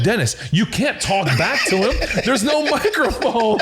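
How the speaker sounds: high, laughing disbelief; incredulous. Retold or imitated. Quarterbacks correcting Dennis at practice, incredulous. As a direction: imitate incredulous teammates, voice climbing high.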